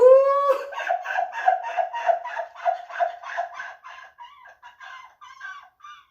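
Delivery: high shrieking laugh fit; helpless laughter. Real, not acted. Cracking up over "domly", helpless high laugh. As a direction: lose it completely laughing.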